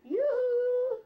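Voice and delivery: high sing-out hail; voice from a cloud. Acted. The Father hailing Kepha from a cloud, high comic call. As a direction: call down in a comic, drawn-out falsetto. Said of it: "had a voice come out of a cloud".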